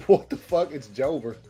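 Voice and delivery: normal talking voice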